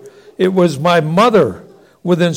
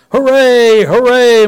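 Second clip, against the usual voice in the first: much higher, louder hooray; cheering; 6 semitones higher, 6 dB louder than usual. Acted. Brother joining in cheering at empty shop, loud and high. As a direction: cheer it out like a thrilled kid.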